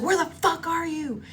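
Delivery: high, frantic, angry demand; screaming mom. Retold or imitated. Mom on the phone to missing daughter, mock screaming. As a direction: imitate an alarmed mother yelling. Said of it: "she's, like, screaming".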